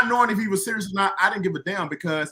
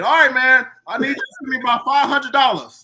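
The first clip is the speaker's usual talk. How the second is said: Kirk's voice; 8 semitones higher, 7 dB louder than usual. Retold or imitated. Kirk voiced asking for money.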